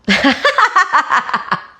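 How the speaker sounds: high burst of laughter; amused laughter. Real, not acted. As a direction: laugh helplessly at your own image. Laughing at the leash image.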